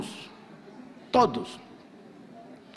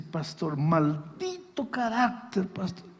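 tearful confession; 6 dB quieter, slower than usual. Retold or imitated. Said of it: "se agarra y dice así".

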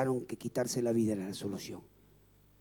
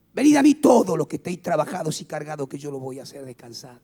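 preaching shout; 3 semitones higher, 11 dB louder than usual. Real, not acted. Preacher quoting Jesus, loud.

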